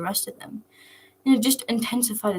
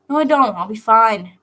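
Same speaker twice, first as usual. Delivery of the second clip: loud, defiant no; stubborn refusal; 10 dB louder than usual. Acted. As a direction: push back hard, refusing help. Nico refusing sleep, arguing.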